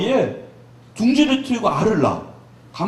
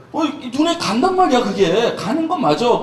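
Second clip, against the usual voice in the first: usual speaking voice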